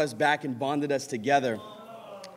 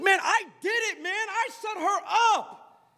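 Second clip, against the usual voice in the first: acted triumph; 14 semitones higher than usual. Acts out boasting of silencing sister.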